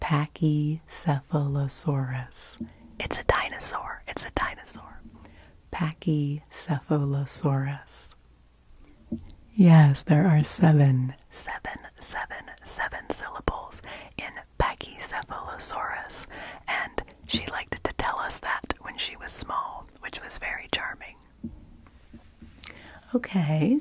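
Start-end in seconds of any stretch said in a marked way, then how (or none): 3.0-5.0 whispered ASMR aside. Acted. 11.3-21.2 soft, hushed ASMR whisper. Acted. ASMR answer and aside whispered.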